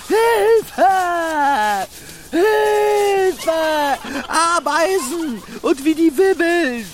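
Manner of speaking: panicked screaming. Acted. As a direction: yell in sheer panic. Schreit um Hilfe wegen Ameisen. (panisches Schreien)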